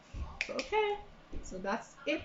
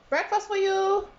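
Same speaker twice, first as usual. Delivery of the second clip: calling out; 10 semitones higher, 5 dB louder than usual. Real, not acted. Calling daughter to breakfast.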